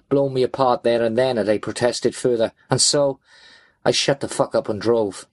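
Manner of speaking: ordinary delivery, nothing marked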